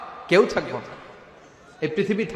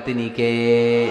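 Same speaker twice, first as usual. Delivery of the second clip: a drawn-out, sung call, lower; 7 semitones lower, much slower than usual. Acted. Preacher calling out the question to the crowd, slow sung call. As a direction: chant the question slowly for the crowd to answer. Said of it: "চিল্লায়া বলেন".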